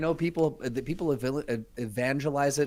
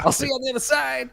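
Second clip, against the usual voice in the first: high, louder mock sendoff; mock farewell; 13 semitones higher, 5 dB louder, faster than usual. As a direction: send him off to sleep dramatically. Joining the falling-asleep bit.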